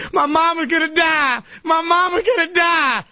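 high, shouted imitation wail; exaggerated high howl of grief; mock bawling. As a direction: wail it like a hammed-up sobbing woman. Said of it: "And starts bawling".

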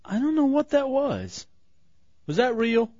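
high incredulous questioning; baffled disbelief. Real, not acted. Host reacting to a bizarre caller, baffled high disbelief.

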